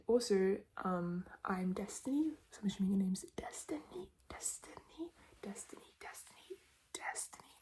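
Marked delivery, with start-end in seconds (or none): 3.4-7.6 very soft ASMR whisper; whispered name repetition. Shown as a demonstration. ASMR host repeating a viewer's name, whispered.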